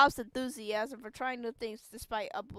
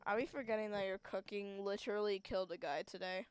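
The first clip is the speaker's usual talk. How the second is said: usual speaking voice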